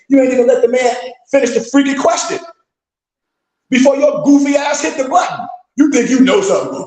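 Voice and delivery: high-pitched, exasperated telling-off; exasperated scolding. Real, not acted. Ranting at people who buzz in too early, exasperated scolding. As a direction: scold them with your pitch pushed right up.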